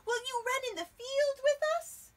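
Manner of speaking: loud excited dog. Acted. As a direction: ask it like a bouncy puppy. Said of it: "said Dog".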